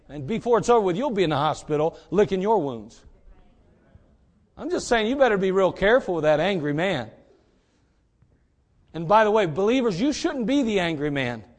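usual speaking voice

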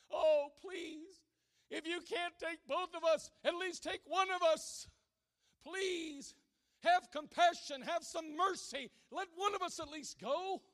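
high, loud desperate pleading; begging. Acted. Mother begging the lifeboat crowd for mercy, desperate. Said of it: "she started to beg those people".

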